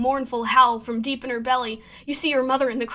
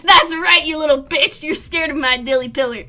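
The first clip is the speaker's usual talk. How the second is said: loud, higher gloating taunt; 3 semitones higher, 7 dB louder than usual. Real, not acted. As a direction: rub it in his face. Taunting the scared Lum.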